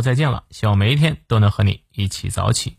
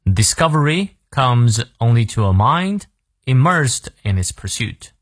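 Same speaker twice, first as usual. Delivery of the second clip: slow, careful repeat for learners; slow model reading. Shown as a demonstration. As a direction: take the sentence through slowly and evenly. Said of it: "再来一遍".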